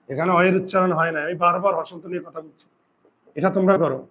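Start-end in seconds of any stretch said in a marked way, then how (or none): none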